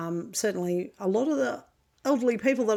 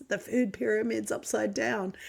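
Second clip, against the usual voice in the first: Cartman; 3 semitones higher than usual. Retold or imitated. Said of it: "where Cartman says".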